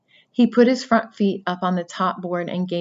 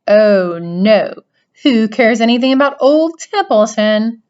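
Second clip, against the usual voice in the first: sarcastic; 4 semitones higher, 8 dB louder than usual. Acted. Rat sarcastic mocking Wilbur.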